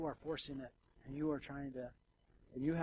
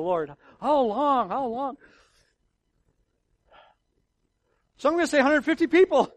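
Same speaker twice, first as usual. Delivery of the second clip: higher, much louder fervent preaching; preaching at full force; 9 semitones higher, 16 dB louder than usual. Acted. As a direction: thunder it from the pulpit.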